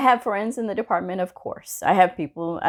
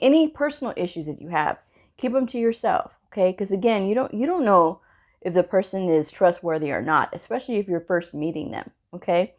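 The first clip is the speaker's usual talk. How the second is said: ordinary talk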